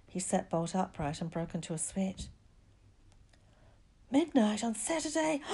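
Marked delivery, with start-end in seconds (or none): 4.1-5.4 high, alarmed repeat of the news. A narrator's reading. Boy suddenly realises the danger, alarmed. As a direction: say it in rising panic.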